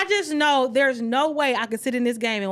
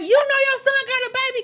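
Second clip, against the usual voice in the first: much higher, fast, louder, half laughing; pointed question; 12 semitones higher, 4 dB louder, much faster than usual. Retold or imitated. Calling his mama to expose the baby, high and fast. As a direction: fire it at his mother fast. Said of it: "I'm like".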